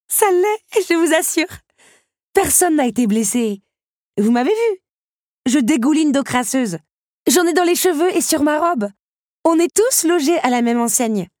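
high-pitched, exhilarated protest; amused insistence. A narrator's reading. Belle assuring the beast it is funny, high and amused. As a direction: insist in a high voice, on the edge of giggles.

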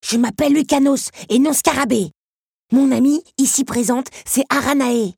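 high, loud, self-important introduction; pompous correction, projected; haughty. A narrator's reading. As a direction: announce the names with offended dignity. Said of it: "d'une voix hautaine".

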